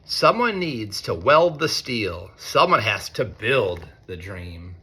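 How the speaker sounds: lightly sing-song storybook reading; reading to children. A narrator's reading. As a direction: read it like a picture book to a class. Reads picture book to students.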